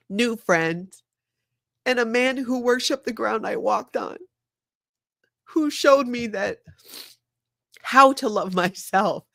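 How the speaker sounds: choked up while telling it; tearful. Real, not acted. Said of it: "I'm gonna cry".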